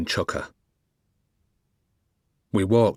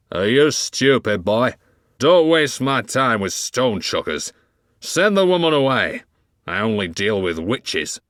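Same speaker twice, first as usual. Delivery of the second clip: scornful retort; 4 dB louder than usual. Acted. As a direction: sneer it down at the boy. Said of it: "Johnson retorted".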